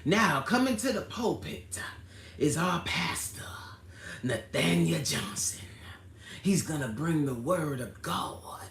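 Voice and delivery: lightly voiced Baptist church announcement, slowed on the name; mock-introduce the pastor, hushing on our pastor; a hint of a church program announcer. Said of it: "sound like an old Baptist preacher name".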